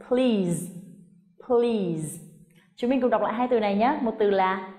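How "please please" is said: "please" as one syllable, long ee, buzzed z; long vowel into z. Shown as a demonstration. Teacher modelling the word twice, slow falling "please" ending in z. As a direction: stretch "please" and end voiced on z. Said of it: "và âm y dài và đến âm z".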